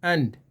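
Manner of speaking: "एंड" with a short uh, not drawn out; short schwa vowel. Shown as a demonstration. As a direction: keep "एंड" short and lax. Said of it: "एंड नहीं करना इसको".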